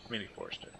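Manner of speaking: breathy whisper to himself. Real, not acted. Muttering to himself while building.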